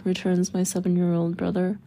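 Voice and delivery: ordinary delivery, nothing marked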